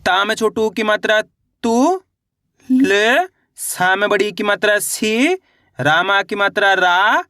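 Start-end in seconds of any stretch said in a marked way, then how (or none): 1.6-3.3 "तू" और "ल" drawn out separately, pitch sweeping up; अलग-अलग उच्चारण. Shown as a demonstration. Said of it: "उच्चारण किस तरीके से करेंगे". 4.8-5.3 "सी" stretched long with a rising end; अक्षर का उच्चारण. Shown as a demonstration. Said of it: "उच्चारण किस तरीके से करेंगे". 6.7-7.2 "रा" stretched long with a rising end; अक्षर का उच्चारण. Shown as a demonstration. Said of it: "उच्चारण किस तरीके से करेंगे".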